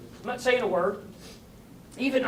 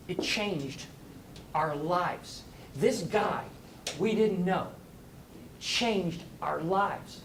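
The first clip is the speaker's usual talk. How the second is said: sincere awe; 2 semitones lower, 4 dB quieter, much slower than usual. Retold or imitated. Woman tells how lunch moved her.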